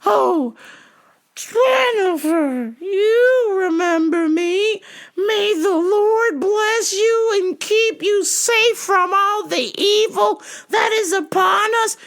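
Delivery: very high, slow quavering blessing; preachy old lady. Retold or imitated. Old caller blessing the clerk, high and slow.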